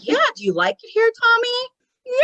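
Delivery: high, quick imitated group question; eager circle. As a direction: gush like an adoring audience to a child. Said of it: "the circle's like".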